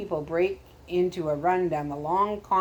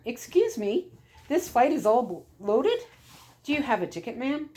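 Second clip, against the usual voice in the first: higher, brisk Irish-tinged woman at the gate; airline gate attendant; 6 semitones higher than usual. A narrator's reading. Attendant telling a latecomer the flight has boarded, higher brisk voice.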